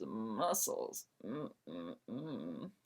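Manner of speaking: low, soft admiring murmur; swooning. Acted. Caitlin swooning to herself over Josh's muscles, soft. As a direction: savour the thought of his muscles quietly.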